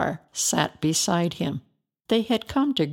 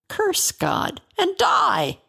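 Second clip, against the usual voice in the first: scornful cry; 9 semitones higher than usual. Acted. Wife scorns suffering husband.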